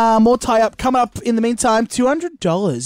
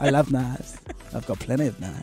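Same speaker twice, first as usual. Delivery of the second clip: low, quiet improvised creepy cashier; serial killer; 8 semitones lower, 9 dB quieter than usual. Acted. Checkout person confiding a love of knives, low serial-killer voice. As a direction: drop low and soft, like a menacing killer. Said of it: "you skewed a bit serial killer at the end there".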